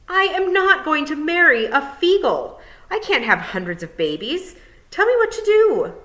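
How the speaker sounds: high, loud refusal; shout. Acted. Tiffany shouting at the toad for advice. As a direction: demand help at the top of her voice. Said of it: "I don't like being shouted at".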